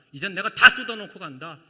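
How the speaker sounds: a loud, pretend outburst that pours it all out; loudly throwing it all out before leaving; mock bitter outburst. Jesus, imagined venting at the Pharisees, mock loud outburst.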